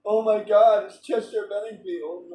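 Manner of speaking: mock fangirl shriek at meeting a star; hammed-up starstruck fan; starstruck fan squeal. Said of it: "I still wouldn't have been like".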